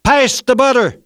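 loud, high-pitched shout; exasperated child's booming demand; boomed out. A narrator's reading. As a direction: let it burst out at full volume. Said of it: "The voice boomed out".

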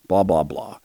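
low, soft dismissive mumble; bored mocking blah. Real, not acted. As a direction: brush it off with contempt. Dismissive mockery of statement.

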